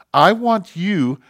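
forceful sermon delivery, pitch lifted; preaching at full force. Acted. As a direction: proclaim it as God speaking to the congregation. Preaches in God's voice.

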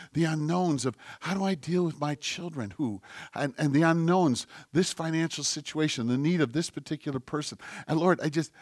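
soft, troubled whisper to God; whispered prayer. Acted. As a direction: confide your worries in a hush. Acting out his troubled prayer.

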